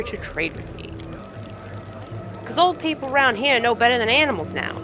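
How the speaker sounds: feisty trader putting the stranger in their place; brash cave trader. Acted. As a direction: haggle like a proud junk dealer.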